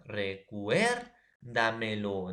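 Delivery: "Recuérdamelo" slowed right down, weight on "cuér"; acento en cuér. Shown as a demonstration. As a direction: draw out "Recuérdamelo", leaning hard on "cuér". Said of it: "en la tras antepenúltima va a estar". («Recuérdamelo» muy lento, con fuerza en «cuér»)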